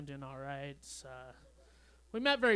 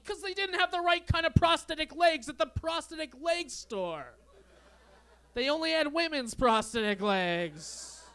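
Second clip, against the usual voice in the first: much higher, pleading whine; panicky whiny excuse; 9 semitones higher, 4 dB louder than usual. Retold or imitated. Lying to the giant man about prosthetic legs, high panicky whine.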